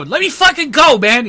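high, forceful shout to be released; desperate yell. Acted. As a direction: yell it like someone being held. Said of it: "you hear somebody go".

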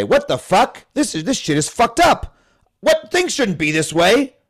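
mock outrage. Young person fed up with the world, outraged high protest. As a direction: act out a young person's incredulous anger. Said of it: "you're mad about it for a little while".

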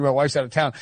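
normal talking voice

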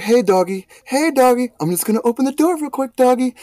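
high, sing-song coaxing voice; coaxing the dog. Retold or imitated. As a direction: sweet-talk the dog from the stairs.

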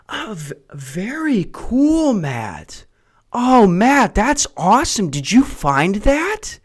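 high, slow sing-song praise; mock praise for a kid. Friends humoring him like a child with a worm, high and sing-song. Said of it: "I just sound like my nephew finding a worm".

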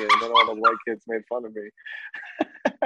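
ordinary talk, nothing marked

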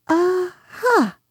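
high, small Piglet voice; shaken Piglet. A narrator's reading. Piglet after his terrifying journey, shaken. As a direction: manage a feeble, high-pitched exclamation. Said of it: "as well as he could after his terrifying journey".

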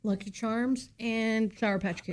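mouth full. Real, not acted. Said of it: "what are you eating over there".

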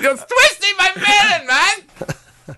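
very high, loud, slow wail; catchphrase impression. Repeating the melon catchphrase for laughs, shrieking mock complaint. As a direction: squeal it out as a hammed-up impression.

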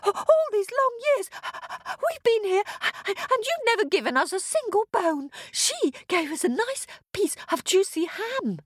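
very high doggy voice with panting. A narrator's reading. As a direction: pant between phrases like an excited dog. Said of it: "said the dog".

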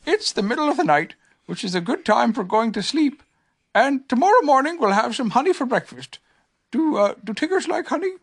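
small gentle bear; Pooh voice. A narrator's reading.

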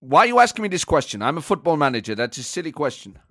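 irritated German manager impression; comic, indignant football boss; Thomas Frank. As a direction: bristle at the interviewer in German-accented English. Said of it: "you're a German football manager".

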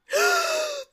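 squealing laugh burst; high shriek of laughter. Real, not acted.

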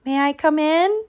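lilting, high-pitched request; calling out. A narrator's reading. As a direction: call politely into a burrow. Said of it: "he called".

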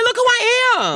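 high, proud show-off voice; mock self-praise. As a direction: preen like you're the best there is. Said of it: "I'm like".

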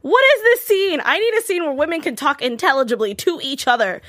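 high, loud incredulous rant; mock outraged fan; exasperated rant. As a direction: rant at the TV in disbelief. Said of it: "And I was like".

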